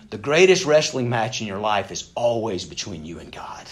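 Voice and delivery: ordinary delivery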